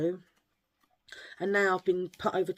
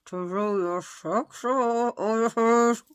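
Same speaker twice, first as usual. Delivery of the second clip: 1 mock ventriloquist voice; 3 semitones higher, 5 dB louder, slower than usual. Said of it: "I'm gonna be a ventriloquist".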